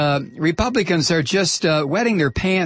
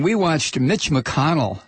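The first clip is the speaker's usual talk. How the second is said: usual speaking voice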